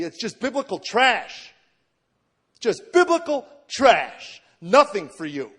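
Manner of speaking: slow emphatic yell, each word hurled out; mock scream. Brain screaming at him over the tapes, mock scream. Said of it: "my brain just screamed at me".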